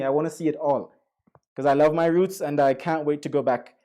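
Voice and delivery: ordinary talk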